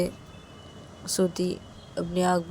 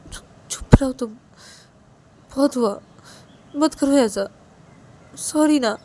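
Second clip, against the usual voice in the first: pleading hush; 6 semitones higher, 5 dB louder than usual. A narrator's reading. Crying girl hushes her.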